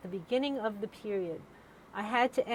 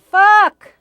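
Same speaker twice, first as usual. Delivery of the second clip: screamed curse, much higher and louder; 11 semitones higher, 23 dB louder than usual. Retold or imitated. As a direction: blurt it at full volume. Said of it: "very loudly".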